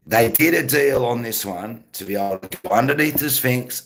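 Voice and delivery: ordinary talk, nothing marked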